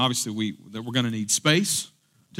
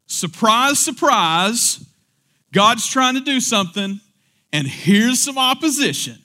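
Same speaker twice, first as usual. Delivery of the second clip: sarcastic surprise; 9 semitones higher, 5 dB louder than usual. Real, not acted. Preacher mocking surprise.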